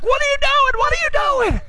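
very high-pitched urgent questioning; alarmed scolding. Retold or imitated. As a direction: call out in high exasperated disbelief. Instructor calls out in alarm.

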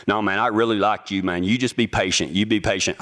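low, lightly voiced manager reassurance; store manager, placating. Store manager to waiting applicant, mildly placating. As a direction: drop low to calm an impatient caller. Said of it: "This is the store manager".

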